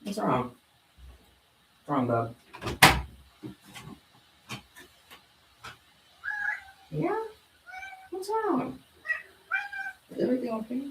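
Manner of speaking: high soft pet voice. Real, not acted. Owner cooing at her cat, soft and high. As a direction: coo gently at the kitty.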